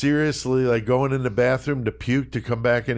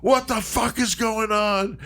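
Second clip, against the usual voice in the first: high-pitched mock shout; hammed-up indignant holler, pitched way up; booming outrage; 8 semitones higher than usual. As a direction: act out a friend's staged restaurant tantrum. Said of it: "really deep booming fucking voice".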